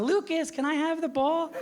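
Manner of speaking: put-on high, slow, small-girl voice; little girl voice. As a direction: ask sweetly like a tiny girl. Little daughter's voice imitated.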